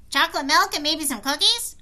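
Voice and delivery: high, loud, eager suggestion; eager kid voice. Acted. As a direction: pitch it up like an excited kid. Andrew's eager reply in story.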